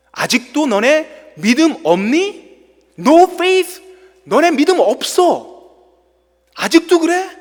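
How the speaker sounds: Jesus scolding disciples for no faith. Acted. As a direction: press the question hard like a scolding teacher. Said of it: "이렇게 꾸짖으시는 거예요".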